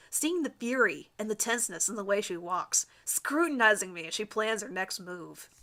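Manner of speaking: ordinary delivery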